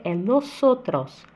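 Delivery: "nosotros" slowed, with a clear s and no z; s not z. Shown as a demonstration. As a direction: correct Spanish "nosotros" with s. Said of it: "Não existe som de Z".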